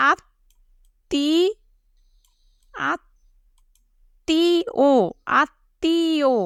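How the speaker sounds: আত্মীয় very slow, in separate syllables; dictation-style syllable breaks; sounded out. Shown as a demonstration. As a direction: stretch আত্মীয় out as "aat... tee... o". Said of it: "আমরা এভাবে লিখি".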